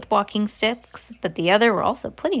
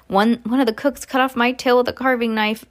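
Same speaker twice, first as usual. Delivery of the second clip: usual speaking voice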